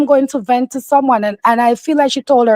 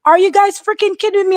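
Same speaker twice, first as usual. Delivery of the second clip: high, incredulous mock outburst; exasperated disbelief; 8 semitones higher than usual. Woman who just dropped off her kid, incredulous and high. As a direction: pitch it way up in disbelief. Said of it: "that's why she said".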